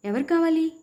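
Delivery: a crazed father's cry at full pitch; loud shout. A narrator's reading. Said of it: "గట్టిగా అరిచాడు".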